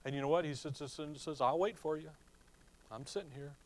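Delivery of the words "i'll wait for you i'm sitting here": easy, unbothered, like a chilled surfer; chilled surfer dude. Retold or imitated. Holy Spirit voiced as patient surfer, laid-back and soft. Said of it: "he's the chilled surfer dude".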